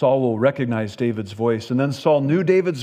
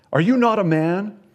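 louder, higher, mocking challenge to Abner; poking taunt; 6 semitones higher, 4 dB louder than usual. A narrator's reading. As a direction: needle the general, voice raised. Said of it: "he's poking at Abner".

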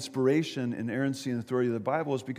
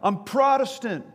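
higher, louder called-out answer; mock yell back; 8 semitones higher, 5 dB louder than usual. Jumper answering bystander's question, mock yelling. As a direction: yell it back in a raised pitch. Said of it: "he yelled back".

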